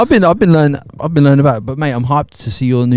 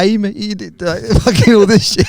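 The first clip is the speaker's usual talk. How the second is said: higher-pitched imitation of a Geordie accent; hint at Geordie, breaking into a laugh; 7 semitones higher, 3 dB louder than usual. Helpful stranger talking to a lost visitor, light mock Geordie accent. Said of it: "in a little Geordie accent".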